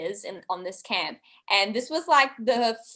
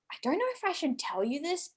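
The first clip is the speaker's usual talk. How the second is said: high, quick, hushed confiding; half-whispered secret; 8 semitones higher, 8 dB quieter, faster than usual. Retold or imitated.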